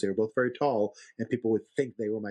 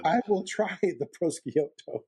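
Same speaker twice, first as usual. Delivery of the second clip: laughing through it; 7 semitones higher, slower than usual. Retold or imitated. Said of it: "And he's like".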